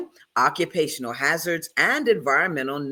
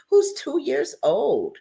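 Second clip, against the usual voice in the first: ordinary delivery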